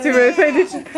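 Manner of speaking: normal talking voice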